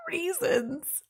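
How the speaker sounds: squeaky laugh breaking into the word; high laughing squeal. Real, not acted. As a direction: laugh high through "reasons". Laughing at an absurd movie.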